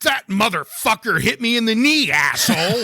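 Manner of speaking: high-pitched, irate wrestler outburst; mock screaming. Podcast co-host quotes an angry wrestler on being introduced, mock screaming. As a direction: holler it as an imitation of a hurt wrestler. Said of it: "as he's screaming at someone".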